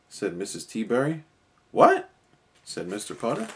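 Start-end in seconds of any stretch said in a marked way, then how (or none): 1.7-2.1 shrieked high, loud what; a high, loud startled yelp. A narrator's reading. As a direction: jolt up in alarm. Old man startled by news.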